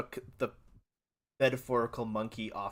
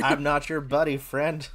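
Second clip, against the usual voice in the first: louder, higher play-angry shout; mock shouting; 4 semitones higher, 9 dB louder than usual. Hockey streamers riffing the buddy bit, mock shout. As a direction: yell it like a fake feud.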